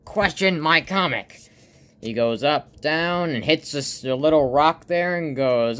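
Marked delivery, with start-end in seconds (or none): 0.0-1.4 high, loud, slow telling-off; stern cartoon rebuke. Stickmon scolds a friend questioning the comic, indignant shout. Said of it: "And Sigmund goes".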